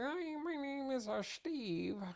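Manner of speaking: high, soft, slow cartoon mouse squeak; mock Mickey Mouse voice. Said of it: "I went into a Mickey Mouse voice".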